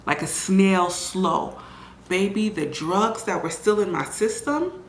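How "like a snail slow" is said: snail slow. Shown as a demonstration. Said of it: "like, slow".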